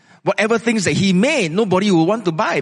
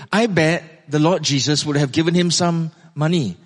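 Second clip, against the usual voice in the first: normal talking voice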